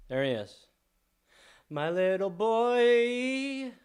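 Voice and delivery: slow, soft cooing to a pet. Real, not acted. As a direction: coo lovingly at the cat. Cooing over a pet cat.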